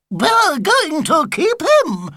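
put-on high, slow, loud old lady's screech. A narrator's reading. Old lady screeching at Mrs Tibblethwaite over Sigurd, high and loud. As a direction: screech it like a clinging old fan. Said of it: "screeched the old lady".

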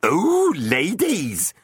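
high, loud mocking squeal. A narrator's reading. Village fool mocks the knight's greeting, shrill and loud. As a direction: mimic the knight to get a laugh. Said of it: "Tom the village fool mocked".

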